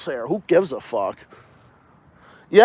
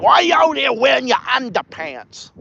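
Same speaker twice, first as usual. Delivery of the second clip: higher-pitched mock New England accent; exaggerated northern US accent, pitched up; big northern accent; 6 semitones higher than usual. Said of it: "big northern accents will say underpants".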